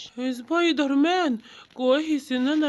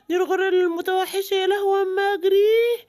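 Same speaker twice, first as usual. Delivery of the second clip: panicked fleeing; 7 semitones higher than usual. Acted. Animal panics seeing gorilla.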